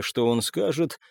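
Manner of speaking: plain reading voice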